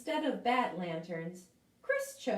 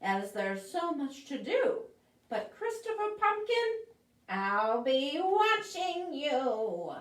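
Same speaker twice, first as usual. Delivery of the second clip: menacing witch; 5 semitones higher than usual. Acted. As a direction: draw out the warning. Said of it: "snapped the witch".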